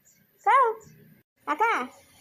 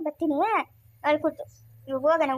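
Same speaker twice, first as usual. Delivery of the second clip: ordinary talk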